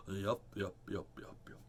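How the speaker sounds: low, breathy whispered yup yup; barely voiced, low puppet whisper. Puppet mutters yups.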